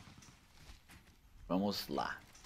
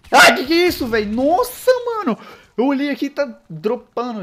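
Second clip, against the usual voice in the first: loud, high burst of disbelief; shocked outburst; 12 semitones higher, 10 dB louder than usual. Real, not acted. Gamer shocked by enemies flooding in, loud high outburst. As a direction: yelp at the wave of enemies. Surprised at enemy wave in game.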